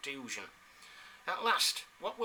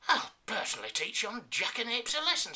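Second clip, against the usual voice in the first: indignant lieutenant; 8 semitones higher, 4 dB louder than usual. Acted. Indignant lieutenant vows revenge.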